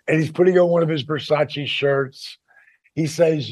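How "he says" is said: normal talking voice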